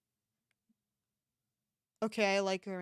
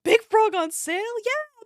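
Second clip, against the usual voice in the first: excited; 12 semitones higher, 9 dB louder than usual. Real, not acted. Excited at frog sale.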